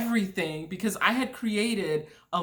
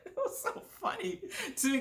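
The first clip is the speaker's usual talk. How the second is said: helpless laughing, higher pitch; real laughter; 11 semitones higher, faster than usual. Real, not acted. Storyteller recalling the push from the plane, cracking up. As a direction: squeeze the words through laughter.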